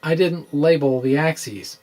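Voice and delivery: normal talking voice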